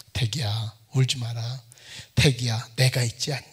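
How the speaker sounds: low soft comforting voice of God; God's gentle voice. Retold or imitated. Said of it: "하나님이 자기한테 말을 하더래". (태기를 달래는 하나님의 낮고 부드러운 음성)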